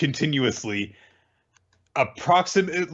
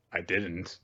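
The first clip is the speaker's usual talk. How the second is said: deadpan; 7 semitones lower, 11 dB quieter than usual. Retold or imitated. Mash deadpan reply.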